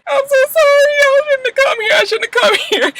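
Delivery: high, sobbing mock apology; crying. Apologizing for coming over, acted crying. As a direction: blubber regretfully, re-enacting herself. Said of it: "Stop crying".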